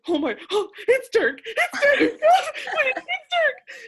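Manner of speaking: high, loud, laughing shriek of joy; screaming with excitement. Retold or imitated. Fan spotting her idol, shrieking with joy. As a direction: squeal it like a starstruck fan.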